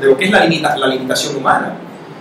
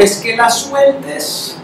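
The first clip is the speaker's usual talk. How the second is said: gentle slow plea; 8 semitones higher, much slower than usual. Retold or imitated. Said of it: "Él dice".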